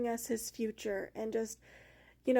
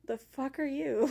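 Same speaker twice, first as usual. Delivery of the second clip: lightly acted blunt snap, a bit higher; blunt retort; 4 semitones higher, 3 dB louder than usual. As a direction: toss it back like a sassy challenge. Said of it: "And Rhiannon was like".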